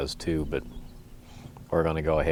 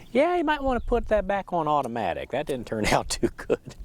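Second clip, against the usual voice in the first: brother's voice; 11 semitones higher than usual. Retold or imitated. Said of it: "and he said".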